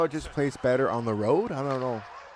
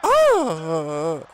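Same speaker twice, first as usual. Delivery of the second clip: surprised shout; 5 semitones higher, 7 dB louder than usual. Real, not acted. Reacting to the play.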